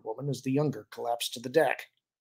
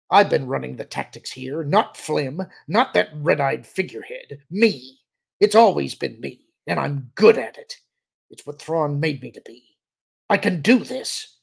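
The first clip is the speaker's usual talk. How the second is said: loud, punched insistence; fervent self-assertion; 3 semitones higher, 7 dB louder than usual. A narrator's reading. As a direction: drive each claim home forcefully, desperate for recognition. Shouting officer claims the credit.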